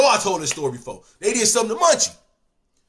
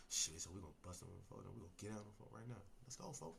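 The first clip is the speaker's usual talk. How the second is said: fast, low, much softer hushed plotting; whispered plan; 11 semitones lower, 32 dB quieter, much faster than usual. Retold or imitated. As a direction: rush the plan out in a hush.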